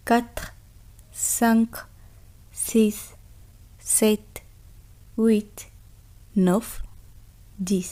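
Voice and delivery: slow, spaced French numbers for students to echo; repeat-after-me counting. Shown as a demonstration. Teacher leading students to repeat, slow and spaced. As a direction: leave room after each word for the class. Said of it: "আমার সাথে বলুন".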